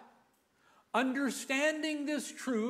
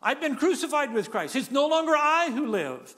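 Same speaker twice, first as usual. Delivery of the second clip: raised, louder celebratory proclamation; rejoicing; 4 semitones higher, 5 dB louder than usual. Retold or imitated. Preacher voicing Paul celebrating his funeral, joyful and loud. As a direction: proclaim it like a victory. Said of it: "he's rejoicing".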